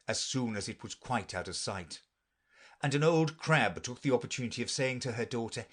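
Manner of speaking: ordinary delivery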